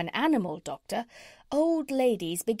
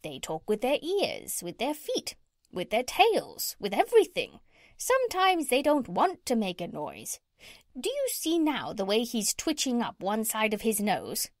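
parrot. Acted. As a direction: lecture like a know-it-all parrot, pitch leaping.

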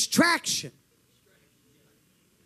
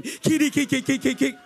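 imitated tongues; 3 dB louder than usual. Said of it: "I was calling kitty cats or something".

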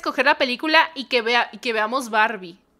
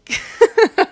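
high, loud delighted giggle; giddy high laugh; 10 semitones higher, 7 dB louder than usual. Real, not acted. Streamer laughing giddily at the crush, high delighted laugh. Character nearly smiled at her.

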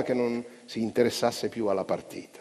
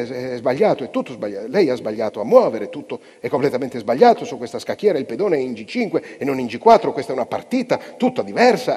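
loud, higher, stumbling panic; agitated stammering; 4 semitones higher, 9 dB louder than usual. A narrator's reading. Confused player protesting about the board, loud agitated stammer. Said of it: "cominciò a balbettare agitato".

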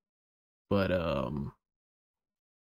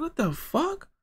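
reacting to the reggae turn, much higher and incredulous; shocked disbelief; slower than usual. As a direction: squeal it out, stunned. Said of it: "The reggae, I'm like".

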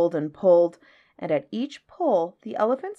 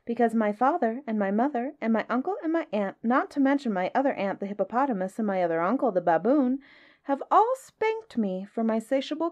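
put-on higher-pitched young elephant voice; elephant's child; 4 semitones higher than usual. Elephant's child explains his spankings to the snake, politely. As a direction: chatter on politely as the little elephant.

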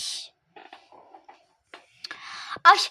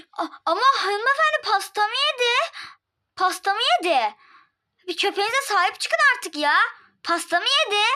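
higher, aggrieved protest; indignant complaint; 8 semitones higher, 4 dB louder than usual. Acted. Guest protests to the dog's owner, indignant and raised. Complaining the dog ate cake.

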